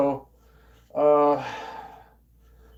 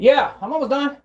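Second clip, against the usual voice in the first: raised, higher-pitched call; 11 semitones higher, 7 dB louder than usual. Real, not acted. Speaker answering someone waiting on him, calling out. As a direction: break off and holler a quick reply.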